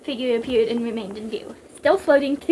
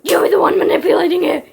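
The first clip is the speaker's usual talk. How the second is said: fast, rough shouted accusation; husky, rapid yell; 5 semitones higher, 11 dB louder, faster than usual. A narrator's reading. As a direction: hurl the accusation at full force. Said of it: "Snake shouted".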